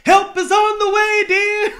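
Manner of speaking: very high, loud falsetto lady; Missus Doubtfire. Retold or imitated. Rushing to save Pierce Brosnan, hammed-up high nanny call. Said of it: "movies is Missus Doubtfire".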